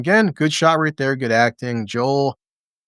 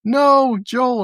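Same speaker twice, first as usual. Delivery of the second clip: mock anguished cry; 10 semitones higher than usual. Ellie crying out.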